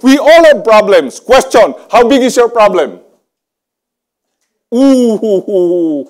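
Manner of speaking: loud, high, driving preacher's call to the crowd; rally the whole hall at full voice; full-force preaching. Acted. Pastor challenging the congregation, loud and fervent.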